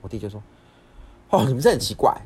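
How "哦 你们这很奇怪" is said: loud, higher-pitched mock grumble; irritated scolding. Brother snapping at his family, irritated scolding. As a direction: act out an irritated complaint, loudly. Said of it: "就又，又这样骂".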